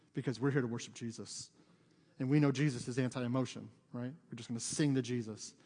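low, mock-solemn church voice; mock emotionless. Preacher parodying a too-serious congregation, mock emotionless. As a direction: imitate a stiff, joyless worshipper. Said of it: "with no emotion".

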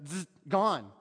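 normal talking voice

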